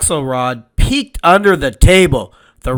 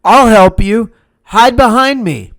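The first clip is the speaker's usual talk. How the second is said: higher, eager urging; urgent boy's voice; 7 semitones higher, 3 dB louder than usual. Acted. Mendel to the matzo man, eager urgent help. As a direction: hurry him into hiding.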